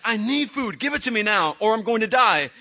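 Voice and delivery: hammed-up desperate cry for food; famished Esau. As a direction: demand it like you're starving. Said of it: "he is famished".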